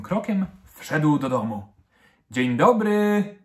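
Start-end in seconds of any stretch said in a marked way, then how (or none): 2.3-3.4 drawn-out, high, loud greeting; joyful call. A narrator's reading. Dad coming home from work, calling out joyfully. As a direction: call out a long, cheerful hello. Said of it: "zawołał radośnie".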